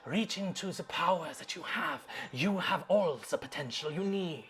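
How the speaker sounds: fairy voice whispering to the barbarian to embrace darkness; seductive whisper. Acted. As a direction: breathe it like a tempting spirit in the mind. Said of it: "that familiar voice from the Fairy Hollow say".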